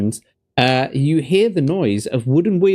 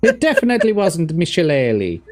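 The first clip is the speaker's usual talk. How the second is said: put-on Hollywood Irish accent; 4 semitones higher than usual. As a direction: joke in a hammy Irish accent. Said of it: "Irish accent there".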